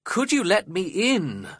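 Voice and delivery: high-pitched pleading request; desperate. A narrator's reading. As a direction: plead to be let in out of the cold. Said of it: "I asked desperately".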